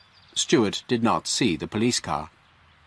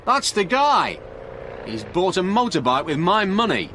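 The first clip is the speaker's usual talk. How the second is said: excited; 9 semitones higher than usual. A narrator's reading. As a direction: point him out, outraged. Frank accusing the thief.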